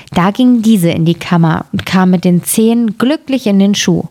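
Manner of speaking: usual speaking voice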